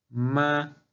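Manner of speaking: "ma" held high and level, like a sung note; first tone. Shown as a demonstration. Teacher demonstrating Chinese tones, "ma" sung high and level. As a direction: sustain "ma" on one high pitch. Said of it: "como si quisiéramos alcanzar una nota alta".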